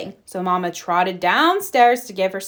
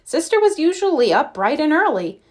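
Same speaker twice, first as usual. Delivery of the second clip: ordinary delivery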